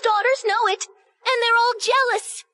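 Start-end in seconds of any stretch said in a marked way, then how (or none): none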